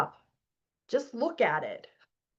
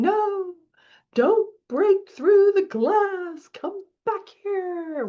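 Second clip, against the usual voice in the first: higher, slow, pleading inner voice pulling back; scared subconscious; 9 semitones higher, 3 dB louder, much slower than usual. Retold or imitated. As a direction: play the frightened inner child begging her to stay. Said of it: "your subconscious going".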